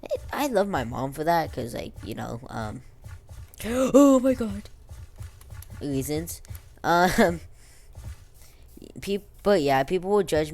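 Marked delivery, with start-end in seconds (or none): 3.2-4.7 high, slow, loud oh my god; genuinely startled exclamation; shocked gasp. Real, not acted. Gasps in shock.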